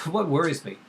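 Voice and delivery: ordinary talk, nothing marked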